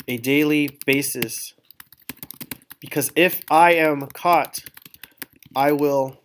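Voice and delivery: slow, measured dictation of a model text; slow dictation while typing. Shown as a demonstration. Teacher typing a sample monologue for the class, slow dictation. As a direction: read out slowly, word by word, as you type.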